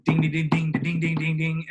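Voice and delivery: impatient bell-ringing noise; imitated bell ding. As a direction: hammer out the desk bell sound. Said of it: "starts ringing it again".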